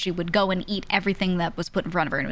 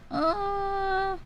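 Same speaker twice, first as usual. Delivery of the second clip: long, high, softer 'uh'; dubious drawn-out uh; 12 semitones higher, 4 dB quieter than usual. Retold or imitated. Unsure about the new mom's decision, dubious high uh. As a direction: stretch out a high, doubtful hum.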